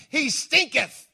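"stinketh" drawn out slowly, King James style; mock-archaic. Shown as a demonstration. Preacher playing up the old Bible word, slow and hammed-up. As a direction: lean on "stinketh", slow and theatrical. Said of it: "Old Testament word".

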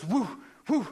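imitated whew of the breathing bag; bag pumping sound. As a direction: puff it out like the bag squeezing. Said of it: "Every time she goes".

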